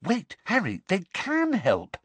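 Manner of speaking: high, insistent appeal; sudden realisation. A narrator's reading. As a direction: stop him with a new idea. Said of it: "said Hermione suddenly".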